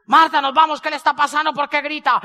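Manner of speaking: alarmed plea to leave; urgent call. Retold or imitated. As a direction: hurry her out the door. Urgently pulling his friend away.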